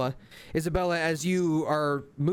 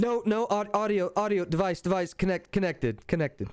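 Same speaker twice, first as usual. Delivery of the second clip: glitching device voice; 5 semitones higher than usual. Acted. Card voice glitching.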